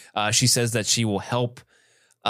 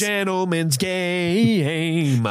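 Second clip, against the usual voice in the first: very drawn-out, higher pompous voice; mock grandeur; 5 semitones higher, much slower than usual. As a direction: stretch it out with playful grandeur. Mock-grand comment on stick fight.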